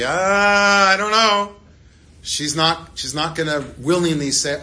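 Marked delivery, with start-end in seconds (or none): 0.0-1.6 slow, higher, louder hesitant shrug; hesitant reluctance. Pretend. Ruth answering Boaz's offer, a hesitant higher drawn-out shrug. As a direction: hold back, unsure of the offer. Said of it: "She's gonna say".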